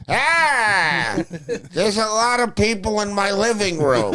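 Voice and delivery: put-on high, quavering old man voice; doddering old coach, lost and rambling; confused old coach. Old coach mumbling about people in his room. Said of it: "He was just like".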